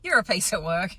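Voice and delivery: husband teasing wife, amused. Retold or imitated. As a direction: lightly mimic him ribbing her. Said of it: "He goes".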